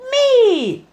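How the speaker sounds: high, loud squeal of delight; cried out. Acted. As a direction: pop up shrieking with glee. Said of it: "cried a pink pony".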